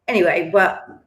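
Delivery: normal talking voice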